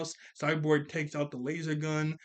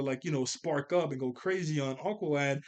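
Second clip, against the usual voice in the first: ordinary talk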